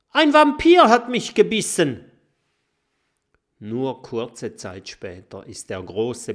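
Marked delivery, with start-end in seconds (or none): 0.1-2.0 viel höher und lauter geschrien; aufgeregter Schrei. Acted. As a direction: schreit panisch um Hilfe. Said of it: "schreit ein aufgeregter Stacheldrache". (aufgeregter Stacheldrache schreit es allen zu)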